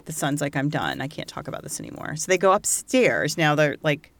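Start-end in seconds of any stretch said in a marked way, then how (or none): 0.6-2.2 fast, breathy whisper of someone at their limit; whispered upset son. Retold or imitated. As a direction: barely voice it, like you can't go on. Upset son wants to stop.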